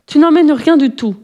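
indignant outcry, pitched high; storybook cry of protest, not a full shout; exclaiming. A narrator's reading. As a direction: cry out to stop him, voice jumping up. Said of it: "s'écria Momo".

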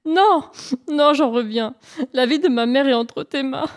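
high, exaggerated tearful wail; crying harder. Hare puts his mother's life in Pan's hands, crying. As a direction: plead through put-on sobs. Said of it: "en pleurant encore plus fort".

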